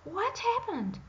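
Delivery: very high, loud, shocked question; startled princess cry. A narrator's reading. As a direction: jump at the thunder. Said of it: "cried the startled princess".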